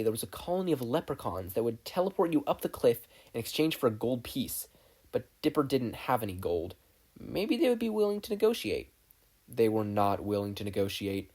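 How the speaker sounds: plain reading voice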